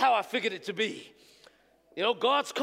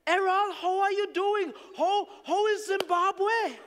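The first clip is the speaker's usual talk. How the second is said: higher, slow, bright greeting of the elder; the elder calls, surprised and friendly; cheerful elder on the phone; 8 semitones higher than usual. Retold or imitated. Elder phoning the pastor abroad, higher and drawn out. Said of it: "to phone the elder".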